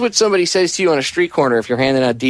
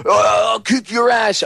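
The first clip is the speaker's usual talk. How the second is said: higher, slow, shouted tough-guy parody; mocking tough guy; 9 semitones higher, slower than usual. Mocking the radio host's tough-guy act with a shout. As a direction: ham up the macho threat, slow and raised. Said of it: "He thinks he's a tough guy".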